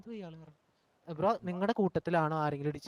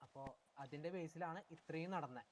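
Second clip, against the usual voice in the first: bad connection; 21 dB quieter than usual. Real, not acted. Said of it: "കേൾക്കുന്നില്ല".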